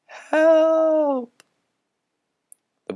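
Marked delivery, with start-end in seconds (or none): 0.1-1.4 very high, thin shout; small voice shouting. Acted. Ghost boy inside the ringing bell, thin high shout. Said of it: "the small voice shouted".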